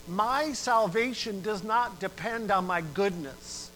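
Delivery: impassioned sermon climax; preaching at full force. Acted. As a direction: drive the appeal home. Preacher exhorts on salvation.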